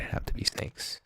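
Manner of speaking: hushed movie-line whisper; whispered quote. Retold or imitated. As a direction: breathe it out under your breath. Indiana Jones line on seeing snakes.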